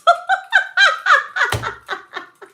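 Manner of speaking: very high, loud laughing fit; real shrieking laughter. Real, not acted. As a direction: lose it completely, laughing. Cracking up at the card.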